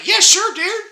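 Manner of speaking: chirpy eager go-ahead to the friend; eager agreement. Retold or imitated. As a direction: jump up high on yeah sure.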